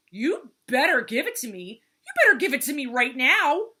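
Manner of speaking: loud, high threat, building; angry demand. A narrator's reading. Turtle threatens Vulture over the bracelet, loud angry demand.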